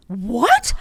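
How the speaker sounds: loud, rising retold shock; one loud word swinging sharply upward; stunned disbelief. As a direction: blurt it out, incredulous. Retold shock at mother's news.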